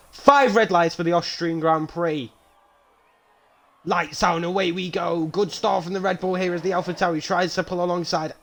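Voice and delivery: high-pitched live race call; energetic play-by-play at lights out; race commentary. Pretend. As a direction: call the start like a TV motorsport commentator.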